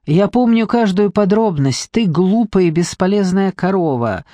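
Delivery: usual speaking voice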